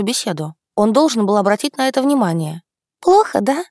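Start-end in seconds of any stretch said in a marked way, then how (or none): 3.0-3.6 mock sympathy. A narrator's reading. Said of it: "участливо спросила Рада".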